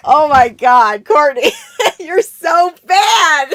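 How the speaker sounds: loud laughing scold. Real, not acted. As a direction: scold her through laughter.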